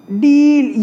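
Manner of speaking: डील with a drawn-out, emphatic 'ee'; stress on long ई. Shown as a demonstration. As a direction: lean hard on the vowel of डील. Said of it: "ये आ रहा है". (डील की ई पर खिंचा हुआ ज़ोर)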